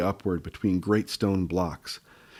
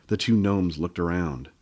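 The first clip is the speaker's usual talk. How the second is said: plain reading voice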